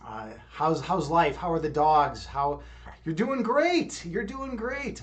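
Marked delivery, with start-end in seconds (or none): 3.0-5.0 high-pitched, drawn-out cheer; delighted. Real, not acted. Streamer hears a viewer is doing well, delighted. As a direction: celebrate the good news, pitch lifted well up.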